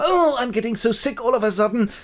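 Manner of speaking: high-pitched melodramatic complaint; ailing young lady. Acted. Young lady suddenly falls ill, high and plaintive. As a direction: announce sudden illness in a high voice.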